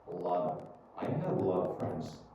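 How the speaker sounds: "lot of" linked with a quick soft D; American flapped T. Shown as a demonstration. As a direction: run "lot of" together as "lodda". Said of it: "you can say a lot of".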